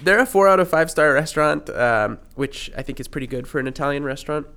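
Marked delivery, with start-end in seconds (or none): none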